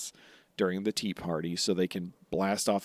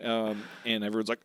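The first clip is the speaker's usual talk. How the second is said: normal talking voice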